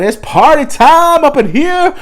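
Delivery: hyped party-host yell; party hype. Acted. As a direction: whoop it up like Friday night just hit. Hyping up the weekend.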